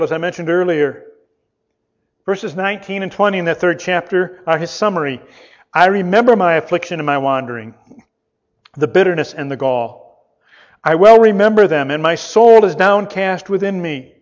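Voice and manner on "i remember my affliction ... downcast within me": louder, pleading lament read from scripture. Acted. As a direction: read the lament with rising anguish.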